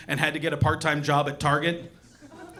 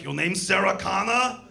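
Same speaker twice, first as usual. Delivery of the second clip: robotic menace. Retold or imitated. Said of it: "He's like".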